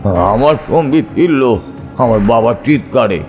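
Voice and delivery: plain reading voice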